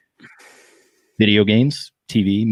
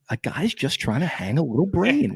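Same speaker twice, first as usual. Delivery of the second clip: mock character voice; 6 semitones higher than usual.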